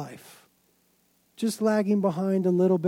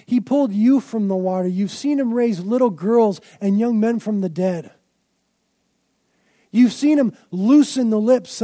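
louder, slow, climactic preaching; fervent sermon build; preaching at full force; 4 semitones higher, 8 dB louder than usual. Acted. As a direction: pile up the miracles, pressing harder each line.

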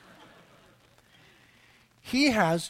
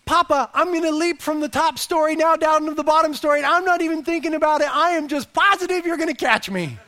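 put-on high, loud, excited little-boy declaration; imitated trusting son; 9 semitones higher, 6 dB louder than usual. Son at top of stairs to father below, excited imitation. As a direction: announce it boldly, as if about to jump. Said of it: "Without saying, it means".

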